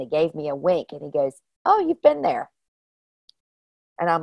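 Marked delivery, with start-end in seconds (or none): 1.6-2.5 lightly voiced old man, raised high; a sly, knowing hint of an old stranger; high, knowing line from a winking old man. Old man winks, quotes him.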